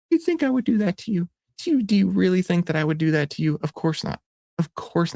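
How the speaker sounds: high, put-on wounded innocence; mock hurt. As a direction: play it falsely offended. Teasing viewers, fake-betrayed high voice.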